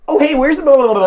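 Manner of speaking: high-pitched, rushed American gabble; mock American tourist accent, racing; fast American accent. Shown as a demonstration. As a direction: rattle it off in an exaggerated American accent. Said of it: "really fast with, like, in a really American accent".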